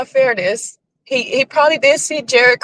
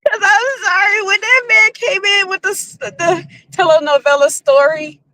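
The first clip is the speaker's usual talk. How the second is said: higher, giggling through the words; still laughing; 10 semitones higher than usual. Real, not acted. Recalling the telenovela story, still giggling. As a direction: come down from a laughing fit.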